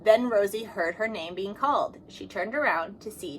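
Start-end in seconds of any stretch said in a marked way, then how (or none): none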